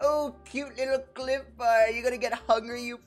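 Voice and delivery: high sing-song taunt; imitated bully jeering, high-pitched; mocking bully. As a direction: put on a high voice to belittle. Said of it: "how do you bully me".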